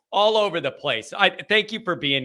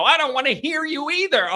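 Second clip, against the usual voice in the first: complaining viewer; 8 semitones higher than usual. Acted. Voices the same viewer again.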